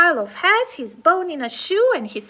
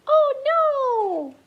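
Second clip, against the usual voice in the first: ordinary delivery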